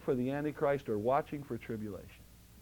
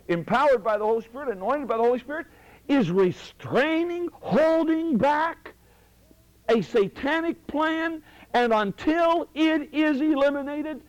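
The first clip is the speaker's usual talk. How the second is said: preaching crescendo; 8 semitones higher, 4 dB louder than usual. Real, not acted. Preacher proclaiming the church's power, loud and building. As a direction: build the sermon to its peak.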